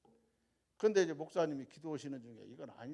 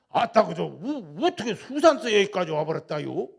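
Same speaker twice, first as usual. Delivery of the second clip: Jeolla dialect; 3 semitones higher, 15 dB louder than usual. Retold or imitated. Landowner greeting the visitor, loud Jeolla dialect. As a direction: greet him big in thick Jeolla speech. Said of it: "전라도 말에 솔찬히 잘합니다".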